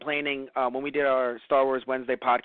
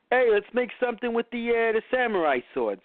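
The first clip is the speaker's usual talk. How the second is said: mock hyped pitch; 8 semitones higher than usual. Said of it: "it wasn't just".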